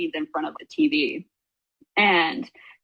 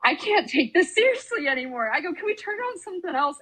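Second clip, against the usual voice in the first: high pleading; 11 semitones higher than usual. Retold or imitated. Scared freshman pleading in car.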